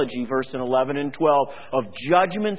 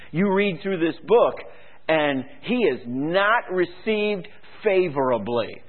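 usual speaking voice

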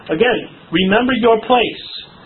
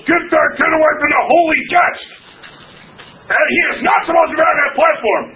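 angry yell; 9 semitones higher than usual. Retold or imitated. Pastor yelling at a kid on the platform, furious shout. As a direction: bark it from the back of the church. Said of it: "he'd yell".

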